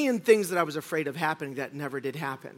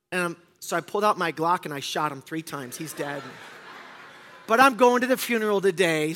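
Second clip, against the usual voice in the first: usual speaking voice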